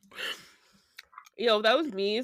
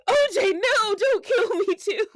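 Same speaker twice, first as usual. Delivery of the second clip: high, louder, hammed-up begging; mock terrified plea; 8 semitones higher, 3 dB louder than usual. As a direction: plead high as if for your life. Acting terrified victim pleading with killer.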